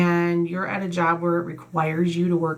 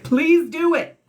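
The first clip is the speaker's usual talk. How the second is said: much higher and louder begging; pleading excitedly; 11 semitones higher, 4 dB louder, slower than usual. Real, not acted. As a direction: beg them to act, voice jumping up. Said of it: "I'm not yelling at you. I'm just like".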